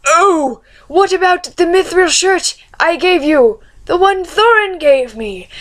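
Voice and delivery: put-on high-pitched old uncle asking hopefully; high, questioning character voice; Bilbo. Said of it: "and then Bilbo went".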